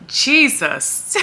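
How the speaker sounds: high teary laugh breaking through; emotional laugh. Real, not acted. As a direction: laugh to keep from crying. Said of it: "I'm getting emotional again".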